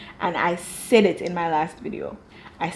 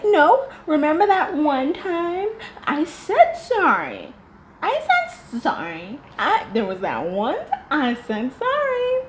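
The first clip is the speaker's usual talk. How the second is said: whiny high mock voice; 8 semitones higher, slower than usual. Mocking the woman's whine.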